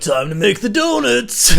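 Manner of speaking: high, slow, drawn-out cry; hammed-up slow holler; absurd climactic announcement.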